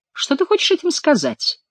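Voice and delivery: high-pitched, exclaiming question; exclaimed question. A narrator's reading. King demanding an explanation from Quélus, high exclamation. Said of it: "воскликнул король".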